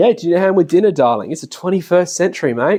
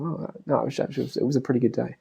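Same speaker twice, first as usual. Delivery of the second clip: much softer mumbled reply; 9 dB quieter, faster than usual. Retold or imitated. Husband catching his own moping, much softer mumble. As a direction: mumble it half-heartedly. Half-hearted correction after sigh.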